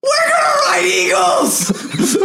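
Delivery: high shrieking joy; excited shout. Real, not acted. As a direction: squeal it out thrilled. Thrilled at riding eagles.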